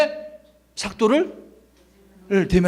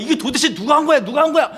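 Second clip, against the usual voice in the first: angry demand; 8 semitones higher, faster than usual. Retold or imitated. Philistines demanding who burned the fields, high outraged. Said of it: "블레셋 사람들이 화가 난 거야".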